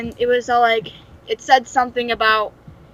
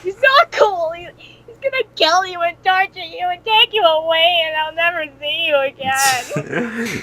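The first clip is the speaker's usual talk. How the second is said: higher, louder hammed-up begging, slowing at the end; mock desperate pleading; 5 semitones higher, 4 dB louder than usual. Warning Knuckles that Satan will kill him, playful frantic plea. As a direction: plead like a clingy little sibling. Pleading friend not to leave.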